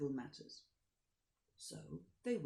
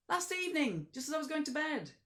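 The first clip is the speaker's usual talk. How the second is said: high, fast offhand coachman; coach driver; 12 semitones higher, 7 dB louder than usual. Acted. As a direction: toss off the answer. Driver answers Count.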